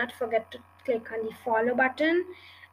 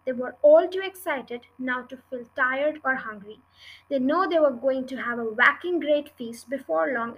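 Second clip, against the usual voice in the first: ordinary delivery, nothing marked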